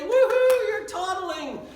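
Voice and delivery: "Woohoo" high, loud and bright, then "you're toddling"; high, loud happy whoop for a baby's first steps; cheering baby. Retold or imitated.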